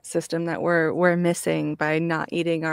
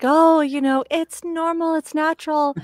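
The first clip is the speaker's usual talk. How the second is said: mocking; 8 semitones higher than usual. Mocking people who celebrate farting.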